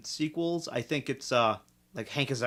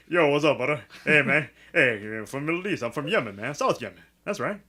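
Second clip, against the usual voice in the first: mock Middle Eastern accent; 3 semitones lower than usual. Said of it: "that's exactly what they sounded like".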